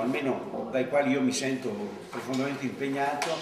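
usual speaking voice

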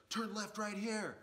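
high, slow mock-bossy order from the back seat; backseat driver order. Label owner in the van bossing the driver, hammed-up high order. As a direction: bark the directions like a pushy passenger. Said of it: "he goes".

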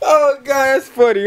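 cry-laughing high and loud; can barely breathe from laughing. Real, not acted. Cracking up at a text, cry-laughing.

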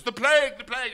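high, hammed-up panicked cries; mock panic. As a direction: cry out like a panicked crowd. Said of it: "everybody goes".

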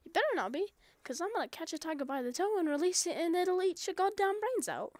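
ordinary delivery

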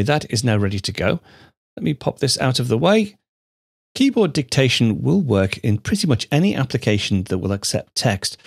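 ordinary delivery

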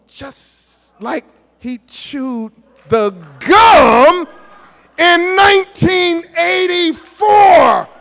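slow, loud, high dramatic buildup; preacher drawing out a punchline; preacher emphasis. Acted. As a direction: stretch it out for the crowd.

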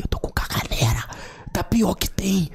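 embarrassed whispered confession; hushed, ashamed aside. Retold or imitated. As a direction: whisper the shameful news. Confessing diarrhea in secret.

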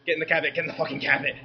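normal talking voice

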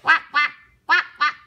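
duck quack. A narrator's reading. Duck quacks in picture book.

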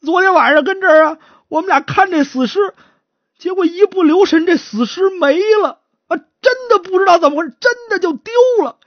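high, tearful account; pleading confession. Acted. Guard confessing how the corpse vanished, pleading. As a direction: confess while begging to be believed.

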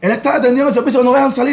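retold crying, pitched well up; imitated tearful woman. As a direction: imitate a woman wailing through tears. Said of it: "la mujer llorando y me decía".

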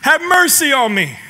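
high, loud plea to Jesus; a blind beggar crying out for mercy. Retold or imitated. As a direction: call out desperately over the crowd. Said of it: "He got loud".

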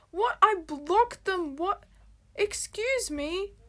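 high, loud disbelief; drawn-out, swooping outraged surprise; incredulous. Real, not acted. Streamer finding the blocked number texting back, incredulous.

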